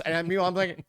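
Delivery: ordinary delivery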